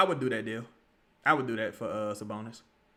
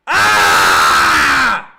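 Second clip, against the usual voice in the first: long, loud, low cry of frustration; frustrated shout; 11 semitones lower, 15 dB louder than usual. Real, not acted. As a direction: let out a pained yell. Frustrated at a play.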